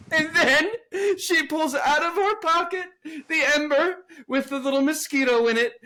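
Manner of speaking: high, laughing disbelief; laughing while telling. Real, not acted. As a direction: tell it while cracking up. Laughing through the story.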